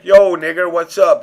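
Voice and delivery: loud, high-pitched mock hail; boisterous greeting. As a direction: call out brashly to a group of friends.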